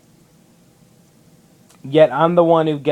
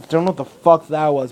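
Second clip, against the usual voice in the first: usual speaking voice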